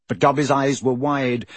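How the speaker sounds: ordinary delivery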